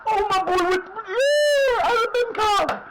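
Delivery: screaming with joy at a goal; ecstatic goal yell. Real, not acted. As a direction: yell it out, high and loud. Goal scored, ecstatic yelling.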